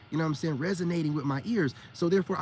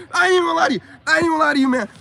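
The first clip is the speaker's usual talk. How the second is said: high, loud, tickled confession; real amused outburst; 11 semitones higher, 10 dB louder, much faster than usual. Real, not acted. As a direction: burst out loudly, cracking yourself up. Cracking up at his own retort.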